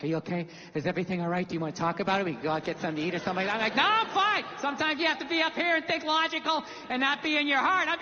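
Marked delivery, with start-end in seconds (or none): 0.0-3.6 low, quick concerned questions; imitated concerned wife. Wife checking on him, retold. 3.8-4.4 high, overdone insistence; defensive denial. Retold or imitated. Denying his feelings to wife.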